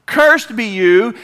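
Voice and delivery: loud, high, drawn-out curse; forceful curse. Retold or imitated. Jesus cursing the fig tree, forceful. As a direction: pronounce the curse slowly and loudly.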